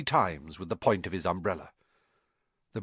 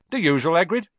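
lightly voiced, louder barman; higher, friendly barman's question; 6 semitones higher, 6 dB louder, faster than usual. A narrator's reading. Barman offering Hagrid his usual drink, friendly. Barman greets regular customer.

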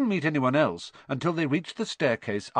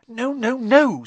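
high quick no no no; flustered Hermione denial; 12 semitones higher, 9 dB louder, slower than usual. A narrator's reading. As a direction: brush him off in a rush. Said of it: "said Hermione".

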